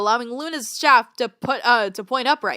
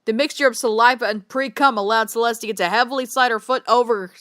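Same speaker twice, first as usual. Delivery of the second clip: plain reading voice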